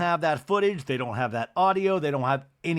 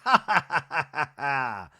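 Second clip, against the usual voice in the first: Miss Patricia. Retold or imitated. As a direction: imitate a put-on "ha, ha, ha" laugh. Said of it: "And Miss Patricia's like".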